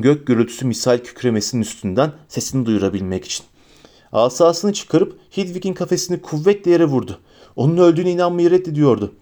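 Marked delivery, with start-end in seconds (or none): none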